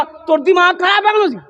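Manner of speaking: high-pitched indignant scolding, acted out; mock quarrelling. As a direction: imitate a villager picking a fight. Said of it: "तो मुअज्जिन से लड़ने आ गए". (झगड़ालू ऊँची आवाज़ की नकल)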